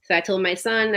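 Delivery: ordinary talk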